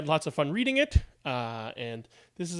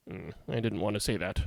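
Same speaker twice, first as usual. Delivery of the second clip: soft, fast grumbled aside; reluctant aside; 8 semitones lower, 10 dB quieter, faster than usual. Acted. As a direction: mumble it reluctantly. Muttered aside after the end.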